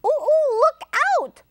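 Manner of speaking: high, loud chimp whoops; very high storybook ape alarm; whooping chimpanzee. A narrator's reading. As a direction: whoop a warning like an excited chimp. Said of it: "whooped the chimpanzee".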